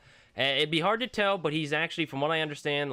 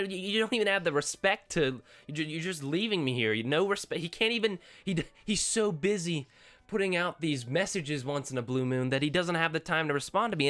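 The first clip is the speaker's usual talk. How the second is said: ordinary talk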